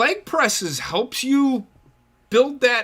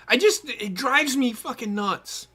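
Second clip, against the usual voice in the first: usual speaking voice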